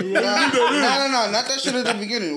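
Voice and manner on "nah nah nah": laughing protest. Real, not acted.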